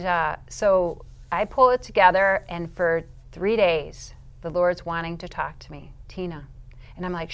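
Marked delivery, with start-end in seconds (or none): 6.1-6.5 soft, understated call of a name; still, quiet voice. Retold or imitated. The Lord wanting to talk to her, still quiet voice. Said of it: "He has that still, quiet voice".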